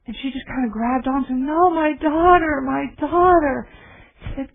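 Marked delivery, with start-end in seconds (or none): 1.5-3.7 high, loud and slow, pleading for her daughter; a mother clinging to a stranger, pleading in grief; pleading anguish. Retold or imitated. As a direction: beg through the grief, drawing it out. Grieving mother clings to man.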